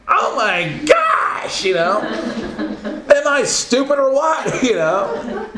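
very high, strained, hammed-up outburst; comic exasperation. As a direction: wail in mock outrage, laughing at yourself. Acts out exasperation at himself.

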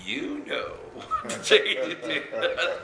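slow, high teasing laugh through the words; chuckling playful reply. Real, not acted. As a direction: tease them while laughing.

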